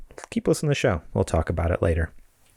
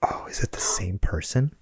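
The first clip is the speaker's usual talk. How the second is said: whisper; 4 dB quieter than usual. Pretend. Mock realization, feigned shock.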